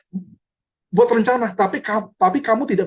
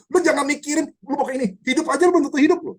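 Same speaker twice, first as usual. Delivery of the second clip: higher, fast and blunt, a mock rebuke; blunt scolding Yakobus; 8 semitones higher, faster than usual. Yakobus scolds the boastful planner, higher and fast. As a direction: fire it off like a blunt scolding apostle. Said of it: "Yakobus itu bener-bener nemplak".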